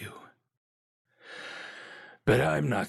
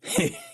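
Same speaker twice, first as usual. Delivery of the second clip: scoffing laugh. Acted. Scoffs at needing protection.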